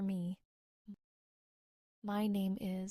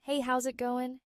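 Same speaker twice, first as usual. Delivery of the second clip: upbeat casual greeting; 4 semitones higher, 4 dB louder than usual. Shown as a demonstration. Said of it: "Repeat after me".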